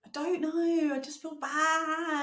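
high, drawn-out guilty wail; guilty whine. Real, not acted. Co-host asked why she's whispering, guilty whine. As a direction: stretch it out high and pitiful.

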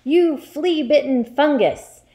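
loud, high, hectoring rant; angry shout. Acted. Headmistress drills and insults a pupil, shouting. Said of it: "shouted the Trunchbull".

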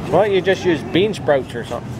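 usual speaking voice

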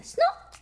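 very high voiced snort; piglet snort. A narrator's reading. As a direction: snort like a happy little pig. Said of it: "Peppa says".

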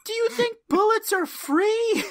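high, loud trash-talking chirp; Shoresy chirp. Acted. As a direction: chirp them like a hockey loudmouth. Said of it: "Mirror Genie's just Shoresy from Letterkenny".

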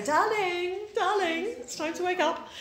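put-on high, gentle coaxing of a sleepy child; lightly voiced loving dad; tender wake-up. Father gently waking his daughter on Sunday, tender coaxing.

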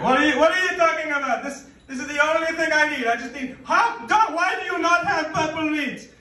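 high, loud and fast outraged shrieking; lady customer raging at the meat clerk; indignant lady customer. Retold or imitated. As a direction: squawk at the clerk like an offended grandmother. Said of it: "And she's like".

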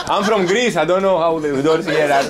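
talking through real laughter; laughing. Real, not acted. Greek visitor excusing himself after failing with the door, laughing.

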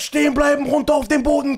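loud barked order to get down; police command shout. Retold or imitated. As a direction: yell it like a raiding cop. (laut gebrüllter Polizeibefehl)

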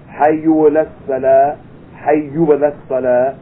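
"Hayyu ala salah" with an u ending on hayyu, chanted slowly; call-like "Hayyu", wrong vowel; mistaken hayyu. Shown as a demonstration. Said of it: "people who say".

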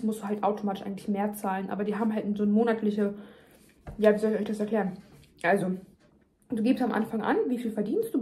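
ordinary talk

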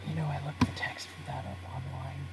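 low, very soft, near-whispered monotone; Cyrus voice. Acted. Boss answering his eager underling, hushed and cold. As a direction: answer in a hushed, emotionless murmur. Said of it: "I'm using my Cyrus voice".

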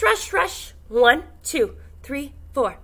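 normal talking voice

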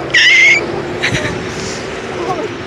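breathy high squeak; airy squeak. Shown as a demonstration. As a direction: squeak like a toy. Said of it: "the squeaking noise I do".

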